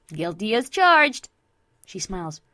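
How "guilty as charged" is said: loud, slow playful confession; brash Vinyl, playful. Acted. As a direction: own up proudly to the gift. Friend playfully owns up to gift.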